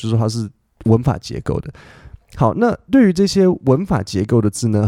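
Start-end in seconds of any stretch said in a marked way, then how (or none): none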